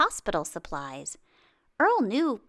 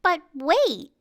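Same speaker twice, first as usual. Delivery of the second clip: higher, louder call to stop; excited interruption; 7 semitones higher, 5 dB louder than usual. Acted. Narrator catching kids after the fake ending, excited. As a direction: stop the listeners before they leave.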